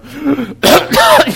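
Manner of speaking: loud mock coughing fit; acted choking cough. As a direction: act out choking on a first lungful of smoke. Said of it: "I remember inhaling and going".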